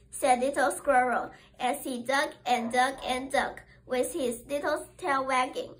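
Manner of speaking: usual speaking voice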